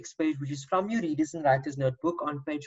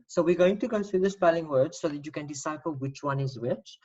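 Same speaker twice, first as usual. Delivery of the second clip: ordinary delivery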